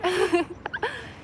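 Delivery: high-pitched giggle; sheepish laugh. Real, not acted. Admitting she liked the Irish accent, high giggle. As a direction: giggle after a confession.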